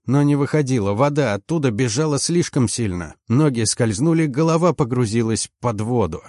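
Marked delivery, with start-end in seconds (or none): none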